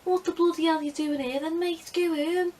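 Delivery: high-pitched mock Scouse; Liverpudlian accent. Detention guy sending a pupil home, Liverpudlian accent. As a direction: do a high, quick Liverpudlian teacher. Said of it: "we weren't Liverpudlian".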